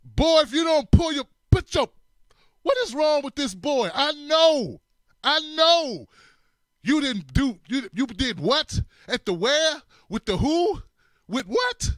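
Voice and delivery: high, loud scolding mother voice; loud, high mock mother scolding her boy. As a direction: scold like an exasperated mom. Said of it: "Now, Timmy, it was".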